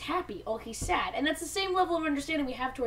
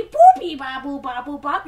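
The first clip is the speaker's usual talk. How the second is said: robot beeping; 13 dB louder than usual. Retold or imitated. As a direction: beep and boop like a little robot. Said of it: "It goes basically exactly like that".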